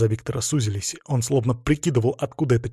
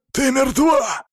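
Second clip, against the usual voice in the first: high, loud cry of terror; frightened, voice cracking; 13 semitones higher, 5 dB louder, slower than usual. A narrator's reading. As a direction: shout it as your voice breaks with fear. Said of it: "Голос его сорвался". (испуганный крик срывающимся голосом)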